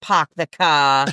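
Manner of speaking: Park the car said the Boston way; Boston accent. Retold or imitated. Teasing about Boston talk, slow Boston accent. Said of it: "There's an R on car".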